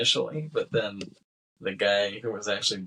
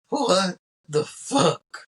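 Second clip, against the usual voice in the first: very slow, high, loud disbelief; mock shocked; 9 semitones higher, 5 dB louder, much slower than usual. McKay seeing Manny kill Wilson, drawn-out shocked exclamation. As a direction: stretch it out in stunned horror.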